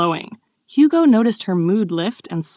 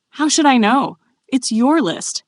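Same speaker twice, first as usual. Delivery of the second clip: higher, insistent retort; dead serious; 7 semitones higher, 4 dB louder than usual. A narrator's reading. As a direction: answer back in earnest exasperation. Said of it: "dead serious".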